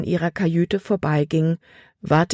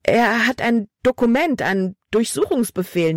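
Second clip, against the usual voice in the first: put-on urgent, higher-pitched girl's voice; alarmed report; 7 semitones higher than usual. A narrator's reading. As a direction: blurt out the bad news. Libby alarms her father. (aufgeregt und drängend)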